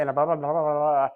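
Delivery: fast, run-together blah blah; rushed normal talk. Shown as a demonstration. Contrasting normal fast talk for students. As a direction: rattle it off quickly. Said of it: "If I just talk normally".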